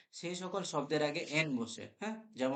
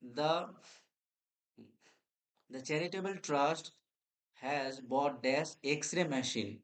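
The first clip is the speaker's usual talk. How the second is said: slow dictation; much slower than usual. Shown as a demonstration. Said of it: "তার পরের প্রশ্ন আমাদের".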